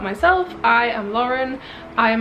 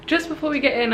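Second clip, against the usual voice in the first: normal talking voice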